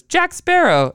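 high, loud mock recognition; imitated exclamation. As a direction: call out the name like the guard. Imitates security guard's exclamation.

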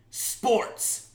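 loud, punched segment title; hammed-up announcer shout; segment announcement. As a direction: belt it out as a show header.

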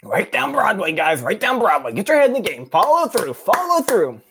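high-pitched coach hollering from the sidelines; hammed-up bowling coach impression; Mister Parker. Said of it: "let me do my best Mister Parker impression".

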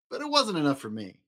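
usual speaking voice

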